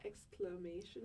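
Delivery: "Exclamation?" said clearly as eks-kluh-MAY-shun; careful pronunciation. Shown as a demonstration. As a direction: model the word "Exclamation?" slowly and cleanly. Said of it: "how would you say it?".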